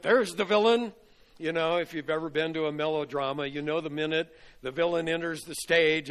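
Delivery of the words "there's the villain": mock accusing shout. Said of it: "all the others went".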